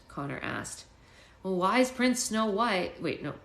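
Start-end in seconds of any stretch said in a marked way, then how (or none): 1.4-3.0 slow storybook reading; story narration. A narrator's reading. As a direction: read it slowly to an audience. Reading story, character line.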